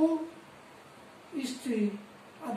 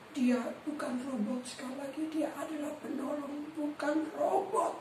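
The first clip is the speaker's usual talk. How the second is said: ordinary delivery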